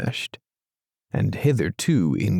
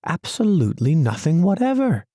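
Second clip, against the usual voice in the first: quick, higher mole voice, matter-of-fact; deadpan honesty; 8 semitones higher, faster than usual. A narrator's reading. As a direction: Mole says it innocently and flatly. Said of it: "with perfect truthfulness".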